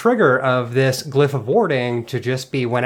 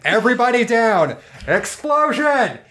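shouting; 9 semitones higher than usual. Acted. Character shouts warning.

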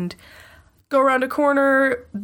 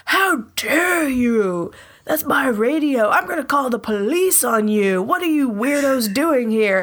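furious guard; 4 semitones higher than usual. Acted. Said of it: "now he is furious. He's like".

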